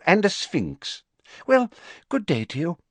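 high, fussy official voice; a busy minister brushing it off; Fudge's voice. Acted. Fudge tells the Prime Minister about Dumbledore in a high fussy voice.

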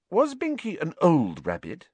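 curious, cautious inquiry; tentative. A narrator's reading. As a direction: probe delicately. Hermione carefully questions grieving friend.

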